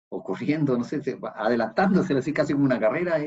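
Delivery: ordinary delivery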